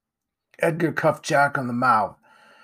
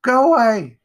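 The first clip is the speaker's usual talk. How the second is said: high, very loud panicked shout; terrified boy yelling; frightened cry; 11 semitones higher, 10 dB louder than usual. A narrator's reading. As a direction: scream at it to leave. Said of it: "he cried".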